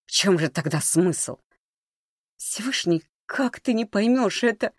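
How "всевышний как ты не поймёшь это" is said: high-pitched, frustrated appeal; exasperated plea. A narrator's reading. Маришка to disbelieving Володя, exasperated. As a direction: plead with pitch jumping up.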